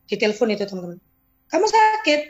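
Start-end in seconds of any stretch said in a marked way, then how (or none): 1.5-2.2 tanya kaget, nada naik tinggi; kaget tak percaya. Retold or imitated. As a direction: kaget mendengar dia sakit. Leader kaget di telepon. (bertanya kaget dengan nada tinggi)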